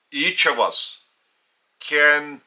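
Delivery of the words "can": ordinary talk, nothing marked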